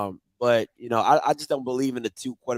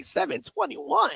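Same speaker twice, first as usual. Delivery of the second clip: surprised; 11 semitones higher, 4 dB quieter, slower than usual. Retold or imitated. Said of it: "I was like".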